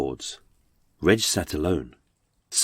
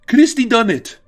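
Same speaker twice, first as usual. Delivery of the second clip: high, loud cry across a courtroom; frantic high-pitched yelling; desperate shout; 6 dB louder than usual. Acted. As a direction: shout it out to clear your name. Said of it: "he shouted these eponymous words".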